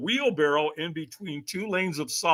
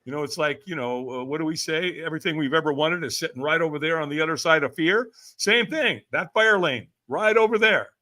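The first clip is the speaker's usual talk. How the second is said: ordinary delivery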